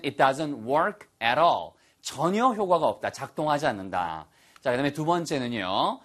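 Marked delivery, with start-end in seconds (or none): 0.0-1.7 all stressed and falling at the end; slow model with stress on all. Shown as a demonstration. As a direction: lean into all to emphasize. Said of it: "거기다 강조하시려면".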